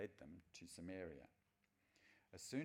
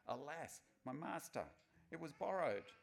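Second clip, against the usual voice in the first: higher and louder, a distressed cry of loss; 9 semitones higher, 5 dB louder than usual. A narrator's reading. The woodcutter cries to his master in distress. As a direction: cry it out, dismayed the axe was borrowed. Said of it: "and he cried out".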